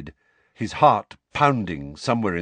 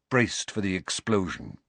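ordinary delivery, nothing marked

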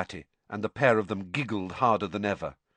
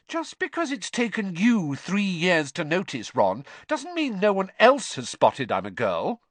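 put-on high, indignant girl's retort; 10 semitones higher, 3 dB louder than usual. Hermione rebuking Ron for not noticing, indignant. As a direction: tell him off, pitched well up. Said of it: "her eyes flashed dangerously".

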